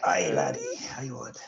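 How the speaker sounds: lightly voiced pirate, aye laddie; a hint of a pirate growl. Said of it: "Now he's a pirate".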